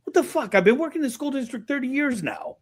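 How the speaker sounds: high-pitched, exasperated comeback; mock indignant retort. Husband answering wife about school job, mock indignant. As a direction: protest way up high, acting affronted.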